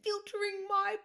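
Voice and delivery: high, loud wail breaking into laughter; laughing comic holler; mock outraged shout. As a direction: escalate the silly outrage, stretching the last words. Escalating silly riff, shouted.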